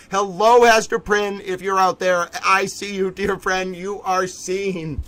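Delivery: ordinary talk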